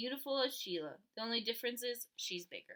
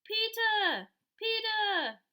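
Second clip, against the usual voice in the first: put-on high, loud little-kid call; high loud child's shout; toddler calling; 10 semitones higher, 7 dB louder, much slower than usual. A narrator's reading. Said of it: "until he called".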